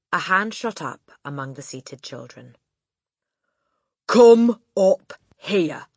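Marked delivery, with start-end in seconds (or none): 4.1-5.9 loud, slow command; shouted. Acted. Headmistress shouting at the boy to come up. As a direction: bellow the order. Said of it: "the Trunchbull shouted".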